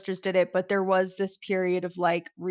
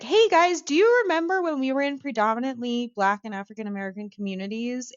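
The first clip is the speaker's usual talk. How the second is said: high, brightly insistent call to the group; pointed mock reminder; 5 semitones higher, faster than usual. Lone dissenter reminding fellow Italian Americans, pointedly chirpy. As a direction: pipe up from the corner, quick and high. Acts out pleading from the corner.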